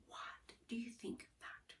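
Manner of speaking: very soft whispered aside; teacher whispers a prompt to the class. Real, not acted. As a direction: whisper it to the students.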